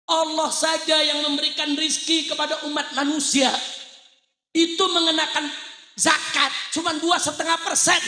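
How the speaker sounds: high, insistent pulpit voice; impassioned preaching. Real, not acted. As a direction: press the grievance home to the crowd. Preaching at raised force.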